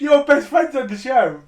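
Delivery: slow, loud, high excited call. Real, not acted. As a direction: drag it out, thrilled. Excited teasing about friend on show.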